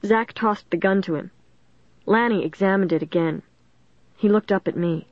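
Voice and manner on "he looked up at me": ordinary delivery, nothing marked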